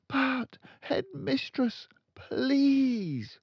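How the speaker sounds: put-on high pleading young teacher; desperate teacher pleading; begging teacher. A narrator's reading. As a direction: beg her to listen. Miss Honey begs.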